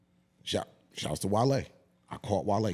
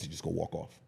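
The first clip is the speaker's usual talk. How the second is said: normal talking voice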